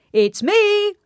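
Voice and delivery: high, loud call through the door. A narrator's reading. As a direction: call out happily to her. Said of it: "called out Mister Happy".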